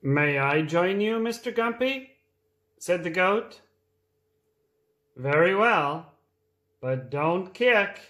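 reading a picture book aloud; lively read-aloud for small children; storytime voice. A narrator's reading.

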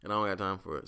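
ordinary talk, nothing marked

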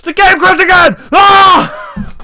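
frustrated shout. Real, not acted. Game crashes mid-recording.

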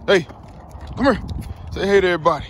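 high sing-song call to the dog; dog-talk call. Real, not acted. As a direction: coax the puppy over sweetly. Coaxing dog to greet viewers.

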